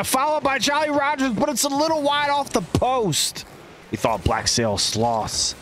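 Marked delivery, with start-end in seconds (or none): 0.0-3.4 rising play-by-play excitement; excited call. Real, not acted. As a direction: pitch jumps high, calling the near miss. Near miss on goal.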